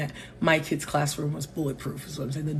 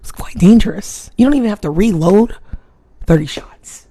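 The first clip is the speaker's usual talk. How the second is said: retold argument; 3 semitones higher, 9 dB louder than usual. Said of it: "I'm like".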